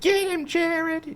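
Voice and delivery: high, slow falsetto cheer of a mom in the stands; mock mom cheer from the bleachers. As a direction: stretch it out like a mother yelling from the bleachers. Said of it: "my mom in the stands that was like".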